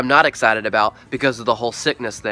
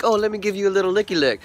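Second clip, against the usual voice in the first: cutesy dog voice; 5 semitones higher, much faster than usual. Retold or imitated. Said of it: "she's like".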